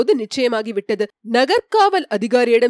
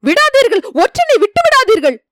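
high, loud frantic yell; panicked shout to the guards, much higher; 10 semitones higher, 5 dB louder than usual. Acted. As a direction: scream at them not to let him go. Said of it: "கத்தினான்".

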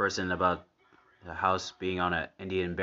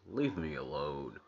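slow, slightly lowered mock-spooky voice; house's retort; 3 semitones lower, 6 dB quieter than usual. Viewer voicing the house brushing off Lisa, slow mock-spooky plea. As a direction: draw it out softly as the house. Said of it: "and the house goes".

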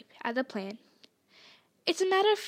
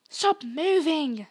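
slow, raised, stretched-out command; higher, emphatic command; urgent order; 4 semitones higher, 6 dB louder, much slower than usual. A narrator's reading. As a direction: order them firmly, drawing it out. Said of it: "Hermione ordered them".

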